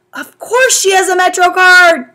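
high, loud exasperated reply. A narrator's reading. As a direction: throw up your hands. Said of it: "fully exasperated".

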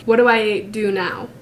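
ordinary talk, nothing marked

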